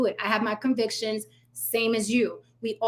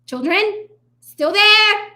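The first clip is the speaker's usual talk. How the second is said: higher, louder teasing call; taunting critics with a higher, louder call; taunting singsong; 10 semitones higher, 8 dB louder, much slower than usual. Real, not acted. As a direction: sing it out to tease them. Taunting viewers.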